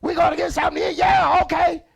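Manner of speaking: shouting high and loud across the room; hammed-up loud coworker shouting down the hall; loud factory worker. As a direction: yell it like a plant worker over machines. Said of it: "You say".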